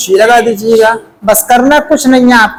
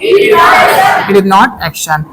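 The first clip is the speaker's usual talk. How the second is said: class chorus; much slower than usual. Shown as a demonstration. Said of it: "क्या बोलेंगे".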